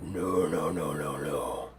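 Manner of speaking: very low, hushed creature voice; menacing octopus. Acted. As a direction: warn them off quietly, deep and soft. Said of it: "He's like".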